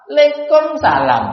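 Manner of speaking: "Waalaikumsalam" ditelan jadi "lekomsala", lebih tinggi; jawaban ditelan. Shown as a demonstration. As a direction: tiru jawaban asal-asalan. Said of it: "Ini bahasa apa?". (jawaban salam yang diejek)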